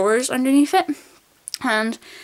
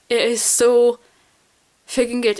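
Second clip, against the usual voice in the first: ordinary delivery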